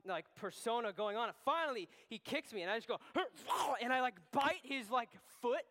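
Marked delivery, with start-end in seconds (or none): 3.0-3.8 dog attack bark. Retold or imitated. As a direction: snarl like a dog about to bite. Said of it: "keep my dog, like, persona".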